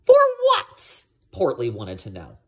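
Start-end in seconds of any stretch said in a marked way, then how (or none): 0.1-1.0 squeaky high puzzled question; high squeak. Acted. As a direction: squeak it out, baffled.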